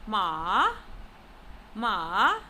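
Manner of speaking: "ma" dips low and swings up; falling-rising tone. Shown as a demonstration. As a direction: push "ma" down, then let it rise. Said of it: "It is pronounced using falling rising tone".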